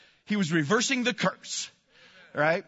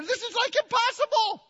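very high-pitched squeak of disbelief; incredulous. Acted. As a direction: voice shoots up into a mock-amazed squeal. Mock disbelief at sprayer never emptying.

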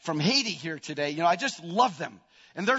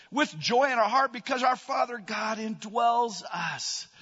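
emphatic preaching; 5 semitones higher, 4 dB louder than usual. Real, not acted. Preacher declaring God's strength to the congregation, emphatic. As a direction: preach it out, stretching the key words.

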